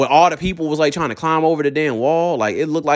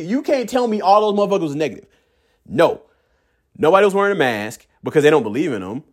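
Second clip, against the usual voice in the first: usual speaking voice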